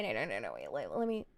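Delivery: normal talking voice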